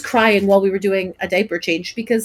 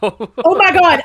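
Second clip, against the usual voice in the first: surprised; 8 semitones higher, 5 dB louder, much slower than usual. Real, not acted. Realizing they ran over an hour.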